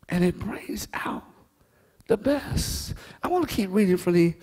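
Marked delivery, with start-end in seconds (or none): 0.1-2.9 breathy hushed whisper to the church. Real, not acted. Preacher whispering the point to the congregation.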